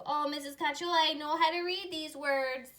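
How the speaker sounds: mock student boasting she can read; imitating an eager student. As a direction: voice the proud pupil lightly. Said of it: "I can hear you".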